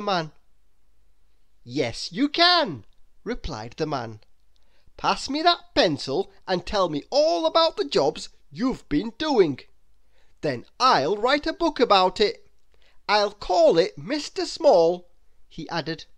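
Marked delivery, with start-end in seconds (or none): 1.6-2.8 slow, louder, kind; a warm welcoming author; lightly voiced author. The author agreeing to hire Mister Small. 5.0-15.1 louder, eager author; an enthusiastic writer; lightly voiced author. The author telling Mister Small about the book.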